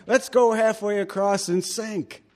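mock high, sarcastic wrong version; sarcastic misquote of a Bible line. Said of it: "He doesn't say that".

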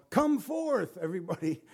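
high mock call to all the dead; jokey high-pitched summons; comic version of the call to the graves; joking command. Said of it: "if he hadn't said Lazarus".

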